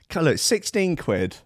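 ordinary talk

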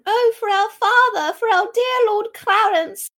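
put-on high, loud child's wail; children. Children lamenting their father Clarence, mock childish wailing. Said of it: "the children".

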